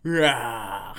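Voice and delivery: growled animal roar; bear roar. Pretend. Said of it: "I will do an impression of what the shape is".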